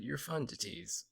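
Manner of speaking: normal talking voice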